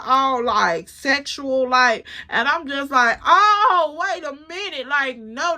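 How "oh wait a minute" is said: sudden high, loud Oh, then drop; shocked realization. Retold or imitated. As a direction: jump up in pitch as it dawns on her.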